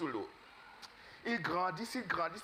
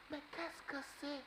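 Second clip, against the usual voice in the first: amazed; 8 semitones higher, 9 dB quieter than usual. Acted. Amazed at a big bird.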